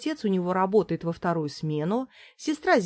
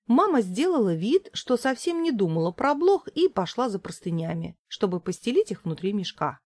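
ordinary delivery, nothing marked

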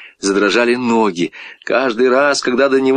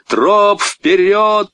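high, calling order; rallying command; 10 semitones higher, much slower than usual. A narrator's reading. Boy urging his dog home, rallying call. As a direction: send the dog onward, pitched up.

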